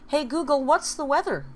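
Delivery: loud, high voice-assistant command; calling out. Real, not acted. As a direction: raise your voice to summon the smart speaker. Calling out to the smart speaker.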